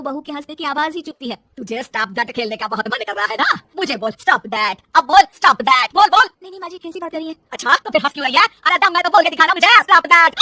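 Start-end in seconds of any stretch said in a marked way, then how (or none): 1.6-10.3 loud, escalating taunt; angry taunting. Acted. Mother-in-law daring daughter-in-law to say the words, furious. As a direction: dare her, getting louder each time.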